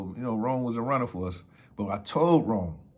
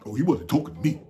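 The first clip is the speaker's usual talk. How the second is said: lightly acted tough dismissal, a little higher; tough-guy front; 4 semitones higher, 4 dB louder, faster than usual. Uncle brushing off the runner's call, mock tough. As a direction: put on a mild tough act. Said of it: "He just put his tough shit on".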